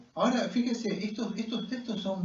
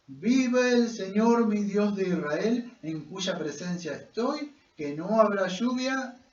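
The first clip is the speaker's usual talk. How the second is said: slow, raised, proclaimed oath; juramento solemne; 4 semitones higher, 5 dB louder, slower than usual. Acted. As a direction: proclaim it slowly, stretching the key words. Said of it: "Él está juramentando". (juramento proclamado, lento y solemne)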